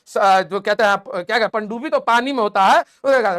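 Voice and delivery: ordinary talk, nothing marked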